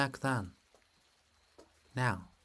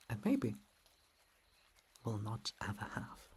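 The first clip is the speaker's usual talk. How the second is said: usual speaking voice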